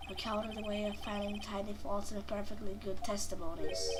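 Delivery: low, soft, smug prosecutor. Acted. Prosecutor needles defense attorney, low and smug.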